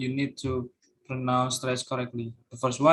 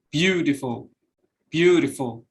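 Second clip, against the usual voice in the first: "beautiful" stressed on BEAU, falling away after; first-syllable stress; 3 dB louder than usual. Shown as a demonstration. Student presenter showing word-initial stress, "beautiful" stressed on BEAU. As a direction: hit the BEAU of "beautiful", let the rest drop. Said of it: "in the beginning".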